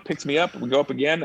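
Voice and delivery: usual speaking voice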